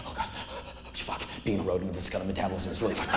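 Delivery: mock panting rabbit, low and soft. Sick rabbit complains in its cage, mock panting. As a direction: play an ailing rabbit, rushed words between pants. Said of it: "they will pant like".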